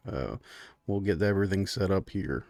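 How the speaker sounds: low, soft, meek husband; browbeaten furbolg host. Acted. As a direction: give in quietly, pitched low. Game master plays Clyde.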